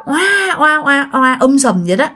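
newborn wailing, mimicked; high "waah" repeated like a crying infant; storyteller's baby-cry imitation; imitated baby cry. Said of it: "nghe tiếng con nít khóc".

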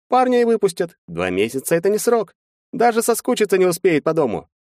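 high-pitched, animated lawyer; lawyer character. A narrator's reading. Lawyer laying out the defense to his clients, high animated lawyer voice. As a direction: voice a lawyer reasoning in high, lively tones.

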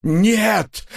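high, anguished outcry; exclaiming. A narrator's reading. Husband refuses to let his wife go, exclaiming. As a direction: burst out in protest. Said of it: "воскликнул Лоханкин".

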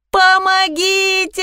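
high drawn-out cry for help; shouting for help. A narrator's reading. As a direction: call out, high and slow. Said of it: "закричала я".

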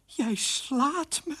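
slow, high, thrilled wonder; elated. Acted. Queen delighted by husband's new spirit, elated. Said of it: "riep ze opgetogen".

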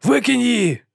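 high urgent command; urgent shout. A narrator's reading. Panic on runaway cart.

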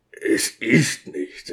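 geflüstert, langsam, nach dem Kichern; hushed. A narrator's reading. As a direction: wiegle es leise ab. Diakon nach Lachanfall. (leise und langsam abwinken)

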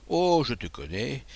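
ordinary delivery

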